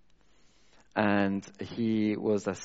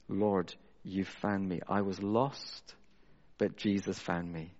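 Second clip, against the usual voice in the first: prayerful; 5 dB quieter than usual. Real, not acted. Preacher remembering before God how he was found, prayerful. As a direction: pray it quietly, with pauses to let it settle.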